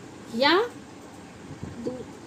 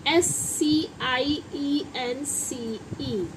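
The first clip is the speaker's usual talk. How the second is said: slow letter-by-letter spelling; 3 dB quieter, much slower than usual. Shown as a demonstration. Teacher spelling the subject's name for students, slowly spelled out.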